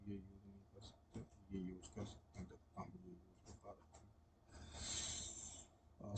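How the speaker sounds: barely audible, low self-talk; real hushed, low mumble to oneself; muttering to self. Real, not acted. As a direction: think aloud under the breath while testing something. Testing the pen, talking to himself.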